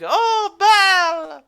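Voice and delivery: high, drawn-out dopey exclamation; dim-witted delight. Acted. Game master voices guard lured by a bell, dopey delight. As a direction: coo at the bell like a dumb dog. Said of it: "follows like a dumb, uh, dog".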